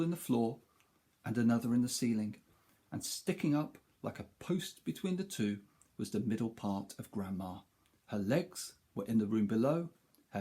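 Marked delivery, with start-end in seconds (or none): none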